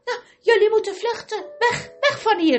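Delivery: fast, high breathless gasping; panting. Acted. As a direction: gasp it out, still out of breath. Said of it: "hijgde hij".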